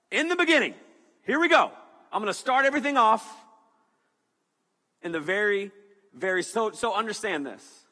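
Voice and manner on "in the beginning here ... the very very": loud, high booming God voice. Retold or imitated. Preacher voicing God announcing creation, booming. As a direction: proclaim it like God starting creation. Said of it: "So God said".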